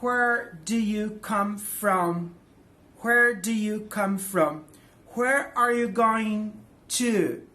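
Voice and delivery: slow, deliberate English model question; teacher demo pace. Shown as a demonstration. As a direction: spell out each word slowly for learners. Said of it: "Por exemplo".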